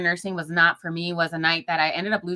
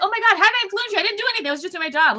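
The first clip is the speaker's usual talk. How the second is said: much higher, light and bashful; modest nurse; 13 semitones higher, much faster than usual. Retold or imitated. Old nurse waving off the thanks, high and bashful. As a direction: brush off the praise, embarrassed.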